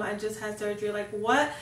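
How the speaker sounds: ordinary delivery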